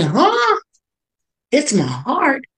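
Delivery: high, puzzled double-take; a stunned, rising "huh?"; startled disbelief. Retold or imitated. Retold shock on waking in hospital.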